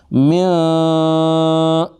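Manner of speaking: میاں hummed through the nose, long and high; nasal tajweed sound; nasal ghunna. Shown as a demonstration. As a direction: hold the nasal hum one alif. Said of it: "ناک میں آواز".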